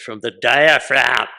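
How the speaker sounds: loud, drawn-out command from the chest; booming voice teacher. Retold or imitated. Voice teacher drilling a young actor, booming. Russian voice teacher demanding projection.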